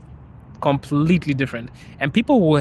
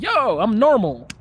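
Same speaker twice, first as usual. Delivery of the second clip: casual self-quote; 7 semitones higher, 3 dB louder, slower than usual. Retold or imitated. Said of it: "I'm here like".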